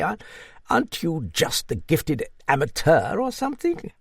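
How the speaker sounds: put-on high-pitched hearty old dame; old Duchess. Narrator voices the old Duchess.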